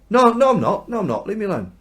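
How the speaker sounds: high, loud, flustered no no; panicked denial. Acted. Peter to the servant girl, a high, loud panicked denial. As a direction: brush her off in a panic, voice jumping up.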